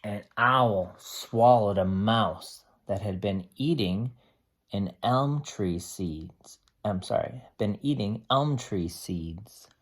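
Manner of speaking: steady storybook read-aloud for a class; reading to children. Acted. Teacher reading a tree book to the class, steady storybook voice.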